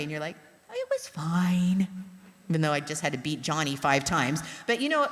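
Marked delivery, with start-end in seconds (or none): 0.7-1.9 slow, drawn-out fake casualness; feigned nonchalance. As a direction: stretch it out as if hiding something. Covering for kids when dad asks.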